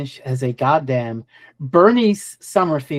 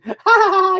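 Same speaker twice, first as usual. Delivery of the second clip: high villainous ha ha ha; evil cackle; 13 semitones higher, 4 dB louder than usual. Retold or imitated. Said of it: "she's, like, cackling".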